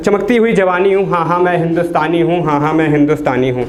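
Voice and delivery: ordinary delivery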